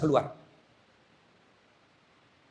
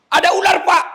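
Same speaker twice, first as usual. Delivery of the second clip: very high, loud yell; panicked shout; 10 dB louder than usual. Acted. Locked-in prisoner warning guard of a snake, panicked shout. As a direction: scream for help from the guard. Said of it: "masih bisa teriak".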